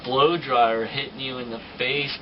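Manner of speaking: ordinary talk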